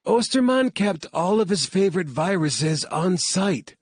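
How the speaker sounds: a high, insistent scientist voice; urgent heroine. Acted. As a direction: warn them firmly about the danger. Claire warns about viruses.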